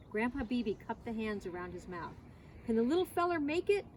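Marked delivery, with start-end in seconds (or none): 2.6-3.8 higher, raised calling voice; mild boom. A narrator's reading. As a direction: call across the water, a touch booming. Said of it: "he boomed".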